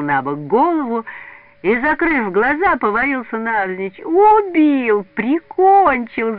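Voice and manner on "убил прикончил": high, loud, drawn-out mock death cry; theatrical boyish holler; hammed-up yelling. A narrator's reading. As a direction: wail it out as if mortally wounded. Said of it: "заорал он".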